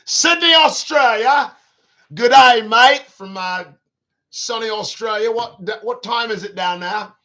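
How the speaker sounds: loud, high-pitched mock Australian accent; playful hammed-up Australian greeting. Host calling out to viewers in Sydney, mock Australian accent. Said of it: "I don't do my accents".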